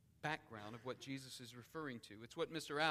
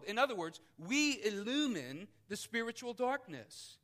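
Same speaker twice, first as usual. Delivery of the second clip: louder, higher, ringing out each phrase; preaching at full force; 6 semitones higher, 8 dB louder than usual. Acted. Preacher reading scripture to the congregation, forceful preaching. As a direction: proclaim it to the whole room.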